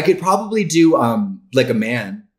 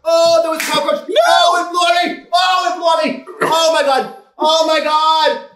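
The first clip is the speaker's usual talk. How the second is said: panicked; 13 semitones higher than usual. Real, not acted. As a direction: panic after a bug lands on you. Cockroach flew onto her.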